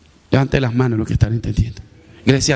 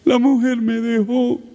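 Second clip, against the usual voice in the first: high, slow, tearful begging voice; weeping plea; 9 semitones higher, slower than usual. Retold or imitated. Man crying to the pastor after service, tearful plea. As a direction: beg the pastor through mock tears. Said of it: "comienza a llorar".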